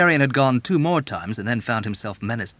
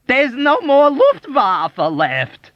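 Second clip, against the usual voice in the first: high, loud, slow wail; 8 dB louder, much slower than usual. A narrator's reading. Doctor wailing in grief to the soldier. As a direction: wail it out near tears. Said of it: "he wailed".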